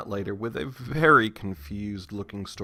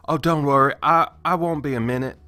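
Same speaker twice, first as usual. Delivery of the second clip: louder, lightly put-on southern accent; fake southern accent; 2 semitones higher, 7 dB louder than usual. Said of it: "my fake southern accent".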